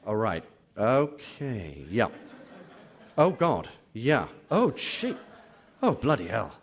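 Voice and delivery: hammed-up reader realising how much is left; mock dismay. Reader's mounting dismay, played for laughs.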